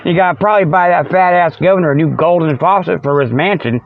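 normal talking voice

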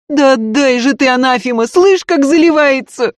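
high, loud frantic pleading; wailing. A narrator's reading. Maid pleading for her crying baby, wailing. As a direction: beg and curse in an unbroken wail. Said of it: "вопила Дунька".

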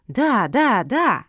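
three emphatic, rising-and-falling cries; eager insistence. A narrator's reading. Pugs begging the turtle, insistent. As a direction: insist on every word, brightly eager.

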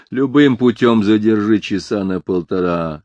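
ordinary delivery

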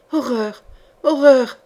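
moaning. A narrator's reading. Terrified prisoner facing the sorcerer, restrained moan building. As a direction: let the repeated word swell in dread. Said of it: "gémit Bruscaille".

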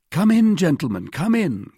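high, loud, ready invitation; calling out. A narrator's reading. D'Artagnan invites the hesitating guards inside, calling out. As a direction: call them in from the door. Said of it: "called D'Artagnan".

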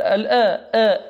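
the hamza of الآ said thin and light; light hamza sound; hamza demo. Shown as a demonstration. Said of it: "الهمزة هي ال".

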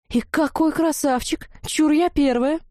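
high, drawn-out excited gushing; animated. A narrator's reading. Redhead claiming the handsome boy first, excited. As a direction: gush and lay eager claim. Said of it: "оживилась третья".

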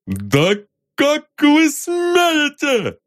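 high strained shout, drawn out; barking shout. Acted. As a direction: bellow it in outrage. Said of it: "гаркнул".

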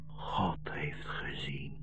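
almost no voice left; last words whispered to the boy; dying whisper. Acted.

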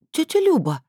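put-on high, indignant girl's protest; outraged at auntie; indignant teen protesting to her aunt. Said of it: "возмущённо уставилась".

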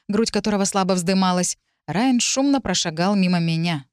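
ordinary delivery, nothing marked

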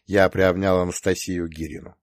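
usual speaking voice

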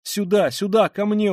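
high, loud urgent plea; pleading. A narrator's reading. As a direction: beg them urgently to come. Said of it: "умоляла Соня". (умоляюще, высоко и громко)

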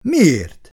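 loud, high combative retort. A narrator's reading. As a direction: challenge it sharply, falling at the end. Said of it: "kérdezte harciasan Szabó".

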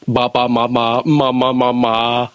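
loose-jawed ba ma syllables; floppy jaw ya ya drill. Shown as a demonstration. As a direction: let the jaw drop on each ma. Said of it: "Then I shall start".

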